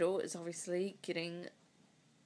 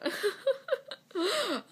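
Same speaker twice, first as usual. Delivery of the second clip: high laugh breaking through tears; a wet laugh out of crying; teary laugh; 6 dB louder than usual. Real, not acted. Woman filming her IVF diary, laughing high through her tears.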